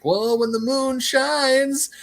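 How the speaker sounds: put-on high, slow drawled old-timer. Retelling old timers in Cars, slow high old-timer voice. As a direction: play a slow old car. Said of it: "they're like".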